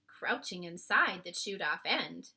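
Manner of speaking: ordinary delivery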